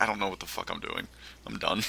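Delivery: quiet resignation. Retold or imitated. Player recalling quitting a confusing game, quiet resignation. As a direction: drop the volume as if giving up.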